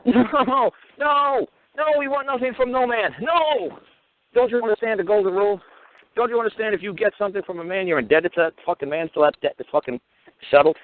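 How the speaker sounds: mock-heated lecture to the court; retold courtroom outburst. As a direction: replay the outburst in court, pitched high and heated.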